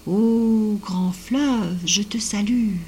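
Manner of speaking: very slow, drawn-out reverent hail; grand salutation. A narrator's reading. Advising the girl how to greet the stream, slow grand salutation. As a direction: stretch the Ô long and hail it grandly.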